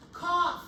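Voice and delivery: a sharp pretend cough; loud mock cough. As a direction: cough it out like a patient. Acted cough check on patient.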